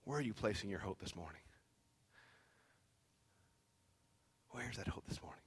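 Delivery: very soft, low appeal with long pauses; hushed preaching. Real, not acted. Preacher consoling a hurting congregation, hushed and low. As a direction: speak low and tenderly to the struggling.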